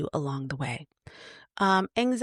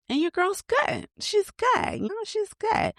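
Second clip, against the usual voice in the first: very high-pitched playful reassurance; high little all-is-well voice. Acted. Playful reassurance that hair recovered.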